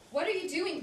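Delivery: high, loud startled question; alarmed question. Acted. Woman to Bob as he undresses her, high alarmed question.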